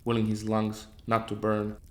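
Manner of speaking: usual speaking voice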